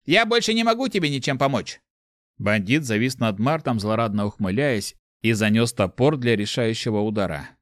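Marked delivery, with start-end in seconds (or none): 0.0-1.8 higher, resigned inner voice; disembodied voice. A narrator's reading. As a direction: give up on him from within. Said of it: "голос в голове Марта".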